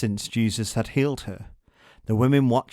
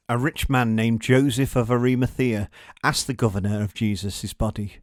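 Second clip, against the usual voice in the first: ordinary delivery, nothing marked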